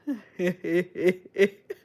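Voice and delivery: giggling fit trailing into breath; real giggle. Real, not acted. Streamer picturing leprechauns for an Irish accent, giggling. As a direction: giggle helplessly at your own silly idea.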